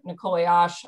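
ordinary talk, nothing marked

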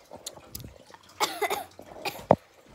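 choking cough on gum; coughing fit. Real, not acted. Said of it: "I choked on my gum".

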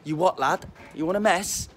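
Scouse accent. Shown as a demonstration. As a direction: put on a confrontational Liverpool twang. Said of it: "hear the twang in my accent".

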